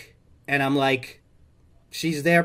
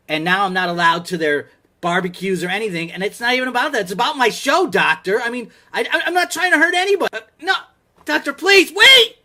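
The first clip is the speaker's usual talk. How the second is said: much higher and louder, building to a desperate cry; rising upset plea; 10 semitones higher, 6 dB louder than usual. Real, not acted. To the doctor ending the call, building desperate plea. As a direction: build from hurt to frantic begging.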